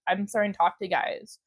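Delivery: normal talking voice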